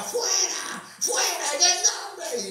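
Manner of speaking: high-pitched cry casting out a demon; retold shouting. Congregation rebuking a possessed youth, shouted high. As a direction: imitate a frantic exorcism shout. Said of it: "una gritadera".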